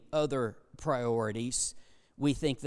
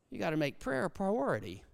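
ordinary talk

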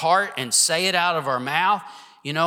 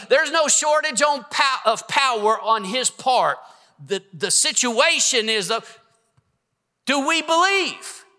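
raised, urgent preacher's voice; preaching; 4 semitones higher than usual. Acted. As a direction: preach it with fire to the church. Pastor preaching to congregation.

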